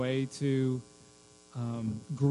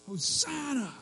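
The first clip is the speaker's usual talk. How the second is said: high, held-back cry of need; pleading cry; 10 semitones higher, 4 dB quieter than usual. Acted. Preacher acts out crowd begging Jesus, pleading cry. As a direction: cry out pitched high, without shouting. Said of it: "but this cry".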